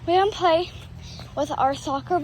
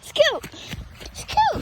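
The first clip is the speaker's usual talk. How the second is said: high called name; calling out; 10 semitones higher than usual. Real, not acted. Owner calling her dog Scoot across the yard, high call.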